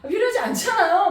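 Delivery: high, loud whiny husband; husband politely protesting, voiced high and loud; imitating husband. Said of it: "repeats husband's line".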